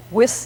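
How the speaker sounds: WIS with an s in place of th; French th-substitution. Shown as a demonstration. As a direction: say WIS, French-style, ending in s. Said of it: "the pronunciation wis for with".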